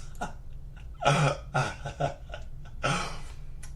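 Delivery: soft, breathy unvoiced chuckles; laughing. Real, not acted. Viewer amused by edibles comment, breathy laughing. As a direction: wheeze out a long laugh.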